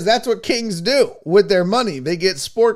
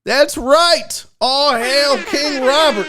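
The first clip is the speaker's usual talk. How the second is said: high, drawn-out hail to the king; mock-royal proclamation; 7 semitones higher, slower than usual. Host hails his contest winner, mock-royal proclamation. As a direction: herald the winner, stretching the name.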